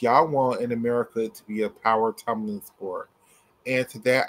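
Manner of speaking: ordinary talk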